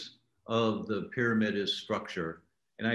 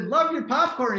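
high, loud, eager fan gush; 8 dB louder than usual. Retold or imitated. Fan greeting Orville in passing, gushing and loud. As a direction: blurt it out like a starstruck fan.

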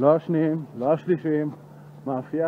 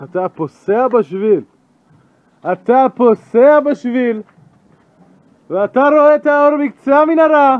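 shouted declaiming; 11 semitones higher, 15 dB louder than usual. Pretend. Walker narrating the path toward the light, shouting grandly. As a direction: declaim it louder and higher with each phrase.

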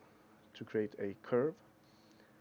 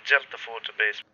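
tin can phone; 10 dB louder than usual. Shown as a demonstration. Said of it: "by adding some high-pass filters".

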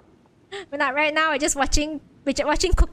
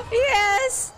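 excited cheering; 8 semitones higher, slower than usual. Real, not acted. Teammate wins solo.

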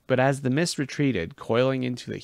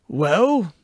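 expectant prompt; 8 semitones higher, 9 dB louder than usual. Acted. Chiron eager for the answer.

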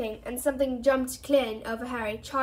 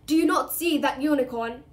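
loud angry centaur bellow; 3 semitones higher, 5 dB louder than usual. Acted. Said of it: "Firenze bellowed at Bane".

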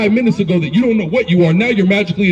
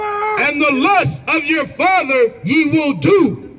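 high, slow scripture reading; scripture declamation; 5 semitones higher, much slower than usual. Real, not acted. Reader proclaiming scripture at an opponent in debate, declamatory. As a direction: proclaim each word slowly, pitched up high.

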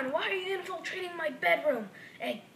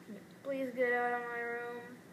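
ordinary talk

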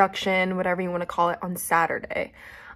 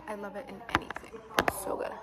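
whisper; 8 dB quieter, faster than usual. Real, not acted. Savouring the food, hushed.

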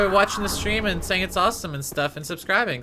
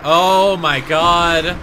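slow, loud groan of disbelief; drawn-out dismay; 10 dB louder, much slower than usual. Real, not acted. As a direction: stretch it out in despair. Dies in game, dismay.

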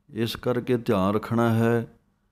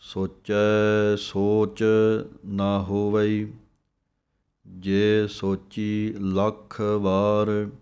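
slow measured chant; slow recitation; much slower than usual. Shown as a demonstration. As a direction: recite each word slowly. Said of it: "ਇਸੇ ਤਰ੍ਹਾਂ ਪੜ੍ਹਨਾ ਹੈ". (ਹੌਲੀ ਹੌਲੀ ਪਾਠ)

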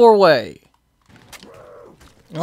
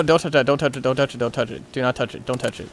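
very soft, low repeated warning; hushed, low, urgent self-talk; tense muttering; 11 semitones lower, 11 dB quieter, much faster than usual. Real, not acted. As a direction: murmur it over and over under your breath.

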